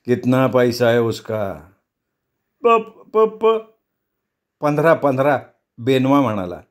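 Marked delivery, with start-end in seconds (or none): 0.1-1.5 slow, level question; very calm, unhurried. Acted. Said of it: "अगदी संथपणे म्हणाला". 2.6-5.4 high, halting start, then the word twice; nervous stammer. Acted. Frightened stammer at knife-point.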